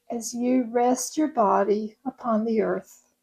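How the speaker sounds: ordinary talk, nothing marked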